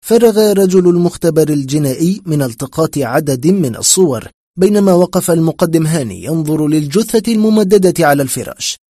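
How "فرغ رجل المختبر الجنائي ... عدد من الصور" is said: ordinary delivery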